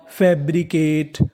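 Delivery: Fabricate said slowly in separated parts; syllable by syllable. Shown as a demonstration. Teacher completing fabricate for students, slowly, piece by piece. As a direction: sound out fabricate as fab, bri, kate. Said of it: "तो पूरी meaning".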